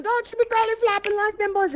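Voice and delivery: a lightly voiced scolding grandma; grandma yelling. Retelling grandma scolding the kids, mock yell. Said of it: "that's grandma's yelling".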